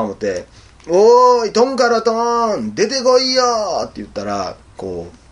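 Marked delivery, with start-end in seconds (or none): 0.9-3.4 high, drawn-out holler; loud summoning call, stretched long. Retold or imitated. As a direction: shout for someone far off to come out. Calling out for the monster.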